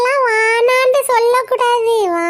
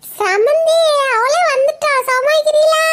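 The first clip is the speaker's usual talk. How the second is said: excited call; 7 semitones higher than usual. Acted. Mother excitedly asks for daughter.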